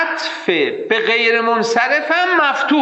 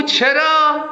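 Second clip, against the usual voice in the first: questioning student; 9 semitones higher, much slower than usual. Retold or imitated. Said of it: "میگو".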